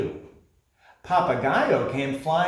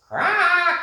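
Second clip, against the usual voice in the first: very high, loud raucous squawk; raucous croak; 6 dB louder than usual. Acted. Parrot greeting the sunrise with a raucous croak. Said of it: "a loud and raucous".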